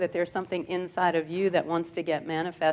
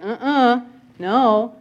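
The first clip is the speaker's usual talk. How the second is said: ego refusing; 3 semitones higher, 7 dB louder, much slower than usual. Retold or imitated. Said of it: "That ego saying".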